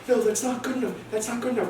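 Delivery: high-pitched insistent rejection; exasperated girl. Retold or imitated. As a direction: reject it in a high, urgent mock-female pitch. Girl rejecting his pasta names.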